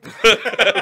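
bright embarrassed laugh; loud laugh. Real, not acted. Laughs about being left off record.

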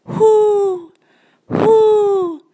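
high loud hooting owl call. A narrator's reading. Owl calling to Brooke, loud high hoots. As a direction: hoot like an owl.

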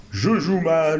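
deliberately hammy voice; bad acting. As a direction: ham it up like a bad actor. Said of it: "Notre ami le mauvais acteur".

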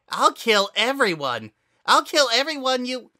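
high, loud escalating threat; building murderous scream. Acted. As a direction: build to a frenzy.

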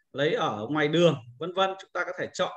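ordinary delivery

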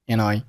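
"and I" joined with d dropped; linked words. Shown as a demonstration. Teacher showing learners linking, "and I" as one word. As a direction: run the n of "and" into "I". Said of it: "and和I连读成了".

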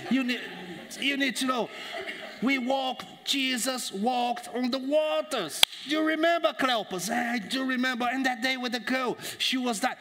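high, animated mock Cockney; Cockney accent. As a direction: retell it excitedly as a South London Cockney. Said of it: "if the guy was from South London".

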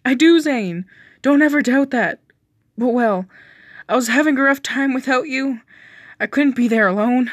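pleading loudly. Acted. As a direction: beg him to believe you. Character pleads during confrontation.

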